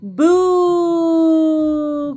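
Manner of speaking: long high held ghost boo; ghostly boo. A narrator's reading. Eight little ghosts answering dad, long ghostly boo. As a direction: float out a long spooky boo. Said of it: "cried the eight".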